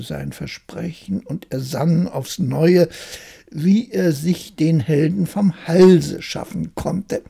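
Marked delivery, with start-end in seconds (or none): none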